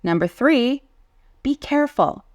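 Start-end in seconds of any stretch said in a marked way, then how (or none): 1.4-2.2 raised, eager be careful; excited warning. Shown as a demonstration. Teacher explaining the answer, says it excitedly. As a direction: call it out with some excitement. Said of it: "I said this with some excitement".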